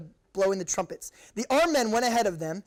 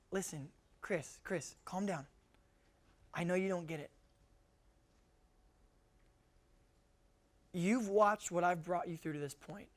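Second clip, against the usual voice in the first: calm reassurance; 3 semitones lower, 9 dB quieter than usual. Acted. God telling the preacher to calm down, gently and softly. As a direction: quietly settle someone down, with long pauses.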